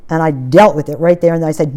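ordinary talk

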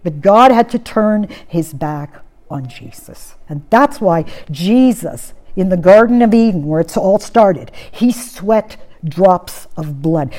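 ordinary talk, nothing marked

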